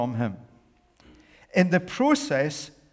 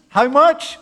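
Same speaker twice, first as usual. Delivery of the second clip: prompting question; 12 semitones higher, 11 dB louder than usual. Acted. Preacher prompts congregation's answer.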